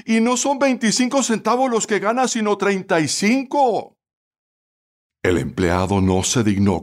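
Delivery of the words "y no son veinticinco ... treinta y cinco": high-pitched indignant protest; acted fury, voice pitched way up; red-hot anger. A narrator's reading. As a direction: argue back as an outraged widow. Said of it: "roja de ira, respondió".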